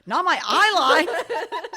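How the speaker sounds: slow, loud, high and laughing; a friend's retort, retold laughing. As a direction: drag it out through laughter. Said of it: "He's like".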